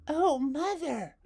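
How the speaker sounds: high, loud, slow wail for mother; crying out. Acted. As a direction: call for her mother in distress. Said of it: "she cried".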